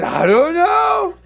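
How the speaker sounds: high, loud, drawn-out mock wail; exasperated shout. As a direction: play a cornered son protesting helplessly. Acted quarrel with his mother.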